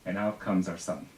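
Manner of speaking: ordinary delivery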